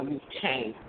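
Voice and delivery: ordinary talk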